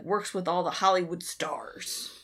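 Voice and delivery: ordinary talk, nothing marked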